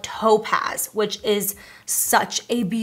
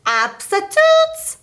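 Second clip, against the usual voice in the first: playful drawn out; 12 semitones higher, 7 dB louder, much slower than usual. Acted. Playful slang emphasis.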